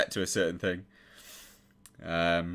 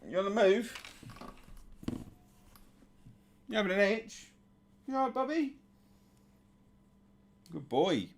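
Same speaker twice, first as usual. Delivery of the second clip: much higher, softer doggy voice; high, sweet pet talk asking if he's okay; 8 semitones higher, 6 dB quieter than usual. Real, not acted. Baby-talking to the dog.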